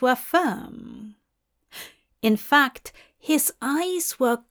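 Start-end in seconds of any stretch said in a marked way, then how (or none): none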